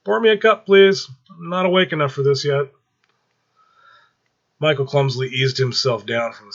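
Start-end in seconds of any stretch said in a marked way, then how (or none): 0.0-2.7 high, loud fed-up plea; weary demand. Acted. Alan rubbing his eyes at Michael flying, loud weary plea. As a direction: beg for coffee like it's too early for this.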